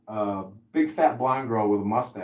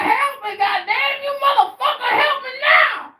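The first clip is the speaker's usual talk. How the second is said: very high, loud cursing shout; enraged shout for help; furious old man shouting; 10 dB louder than usual. Retold or imitated. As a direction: scream curses at the passer-by. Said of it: "He's like".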